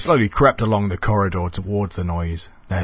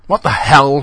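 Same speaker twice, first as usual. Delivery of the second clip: high, loud startled exclamation; taken aback, pitched up; 6 semitones higher, 6 dB louder than usual. A narrator's reading. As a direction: blurt it out in shock.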